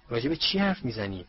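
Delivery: usual speaking voice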